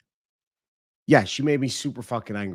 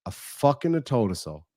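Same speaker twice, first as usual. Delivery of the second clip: ordinary delivery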